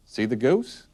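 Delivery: usual speaking voice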